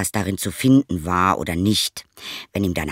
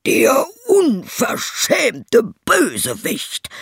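high, slow, outraged shout; furious yell; 4 dB louder, much slower than usual. Acted. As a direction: scream at the rascal. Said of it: "schrie der Schneider".

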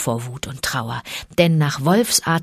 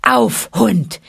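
louder, higher command; shouting; 4 semitones higher, 3 dB louder than usual. A narrator's reading. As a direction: bark at the tiger. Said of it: "schrie Mowgli ihn an".

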